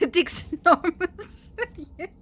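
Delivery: cracking up on a rude joke name; laughing hard. Real, not acted. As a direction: get the words out through giggles. Reads rude name while laughing.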